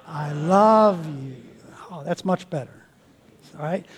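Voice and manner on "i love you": slow, sincere I love you; mean every word of it; heartfelt. Shown as a demonstration. Pastor models saying it to your wife, heartfelt. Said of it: "say it with meaning".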